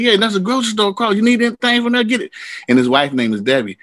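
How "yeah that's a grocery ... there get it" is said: high, fast generous friend's voice; pitched-up quick offer; James impression. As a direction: rattle it off like a big-hearted friend. James voiced in a retold story.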